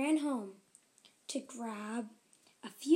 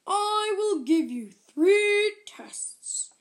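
slow, loud, booming ogre; 4 semitones higher, 10 dB louder, slower than usual. Acted. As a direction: lay down the tests like a huge ogre. Ogre sets her three tests.

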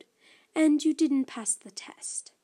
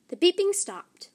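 usual speaking voice

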